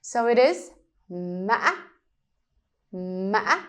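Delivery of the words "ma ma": ngã tone on ma, broken rise; Vietnamese tumbling tone. Shown as a demonstration. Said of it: "sounds like you trip".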